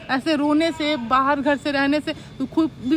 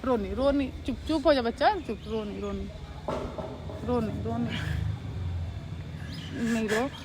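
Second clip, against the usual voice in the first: soothing hush; 4 semitones lower, 10 dB quieter than usual. Acted. Sister soothing crying brother.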